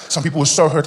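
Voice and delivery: ordinary talk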